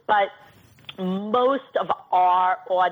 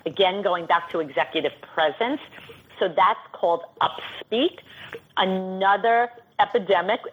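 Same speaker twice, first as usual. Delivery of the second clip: ordinary talk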